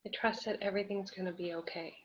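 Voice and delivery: soft, soothing mantra; calm affirmation. Shown as a demonstration. Coach models a trust phrase for anxious client, calm and soft. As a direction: say it softly, as quiet reassurance.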